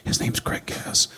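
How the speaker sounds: hushed, breathy tip-off; secretive whisper. Retold or imitated. Someone secretly tipping off Gene, whispering. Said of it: "someone finally told him".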